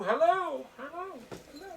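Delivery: high shouted hellos fading like an echo; cave echo. Pretend. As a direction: call into a cave, let it echo away. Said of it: "I shout into the cave".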